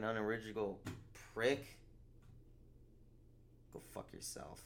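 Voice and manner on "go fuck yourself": muttered. Real, not acted. Muttered insult aside.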